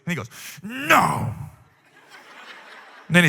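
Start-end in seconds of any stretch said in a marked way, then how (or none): 0.6-1.4 put-on sulky little-kid no; pouting no. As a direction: pout the word out like a toddler. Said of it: "he's pouting".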